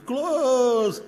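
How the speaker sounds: high, punched final word; emphatic finish. Acted. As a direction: cap the list with a flourish. Wraps up his list of steps.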